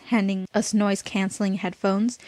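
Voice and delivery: usual speaking voice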